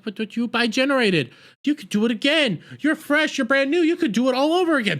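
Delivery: high-pitched, exasperated argument; incredulous protest. Acted. Reviewer arguing back at the doctor, high incredulous protest. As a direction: plead the obvious, rising and speeding up.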